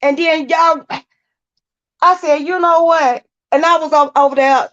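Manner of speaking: heated, flustered rant; agitated and high. Real, not acted. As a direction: pitch up, words tumbling out. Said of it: "I can't even get my words out".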